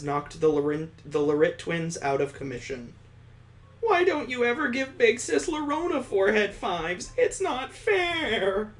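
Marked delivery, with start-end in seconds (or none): 3.8-8.7 put-on high, childish pout, dragging out "It's not fair."; a jealous big sister whining for attention; whiny big sister. Reader voices Big Sis Lorona.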